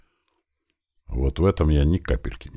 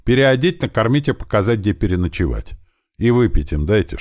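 raised, brisk string of commands; shouted orders; 7 semitones higher, 4 dB louder than usual. A narrator's reading. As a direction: call instructions out to a subordinate. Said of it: "крикнул он одному из бойцов". (громко отдавать распоряжения)

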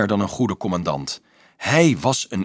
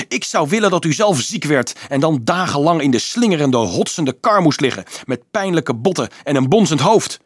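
furious tirade, higher and louder; rage, voice cracking; 6 semitones higher, 5 dB louder than usual. A narrator's reading. Boy cursing the priest with a sickbed, raging. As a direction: hurl the wish at him in anger. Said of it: "terwijl drift zijn stem deed overslaan".

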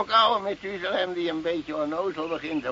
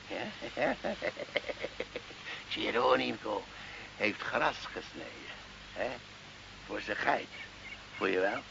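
gniffelend fluisteren; 5 semitones lower, 10 dB quieter than usual. Acted. Sly secret whispered.

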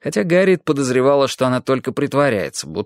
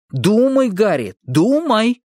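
higher, slow, hammed-up boy; an overdone dramatic plea; theatrical exclaiming; 10 semitones higher, slower than usual. A narrator's reading. Boy theatrically urges Harriet to remember, higher and slow. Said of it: "театрально воскликнул он".

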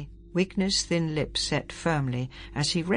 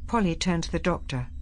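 usual speaking voice

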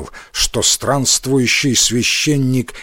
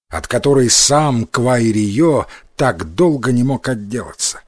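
plain reading voice

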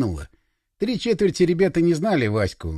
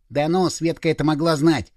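ordinary delivery

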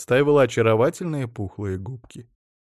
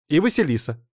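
firm, higher-pitched insistence; stern; 5 semitones higher, 3 dB louder than usual. A narrator's reading. Wife adding her therapist to the deal, stern. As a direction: add it strictly, no argument. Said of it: "строго сказала жена".

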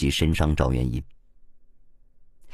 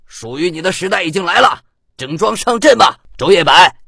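high, loud battle cry; rousing shout; 10 semitones higher, 5 dB louder than usual. A narrator's reading. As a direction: shout it like a call to arms. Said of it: "魏琛又一声大喝". (高声大喝，慷慨激昂)

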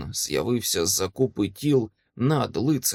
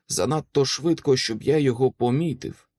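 plain reading voice

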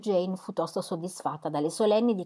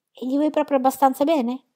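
higher, louder playful question; teasing sister; 7 semitones higher, 6 dB louder than usual. Acted. Elizabeth teases Jane about Bingley, higher and louder. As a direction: needle her sister a little.

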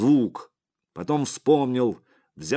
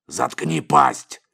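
loud, raised, drawn-out bark; barked order; 5 semitones higher, 8 dB louder, much slower than usual. A narrator's reading. Boss silences his bodyguard, barking loudly. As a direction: roar it to shut him up. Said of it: "рявкнул Сипякин".

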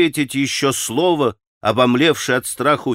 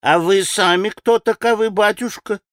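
put-on high-pitched old servant woman, inquiring; старушка другим голосом; 7 semitones higher than usual. A narrator's reading. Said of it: "совсем другим голосом проговорила старушка". (высокий голос любопытной старушки)

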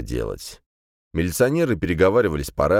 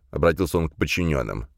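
usual speaking voice

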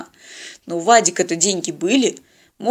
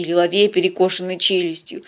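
usual speaking voice

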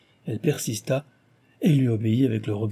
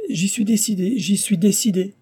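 high-pitched, insistent repetition; agitated, high assent; urgent cry; 9 semitones higher, 3 dB louder than usual. A narrator's reading. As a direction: exclaim it eagerly, voice shot up high. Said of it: "s'écria Cécile".

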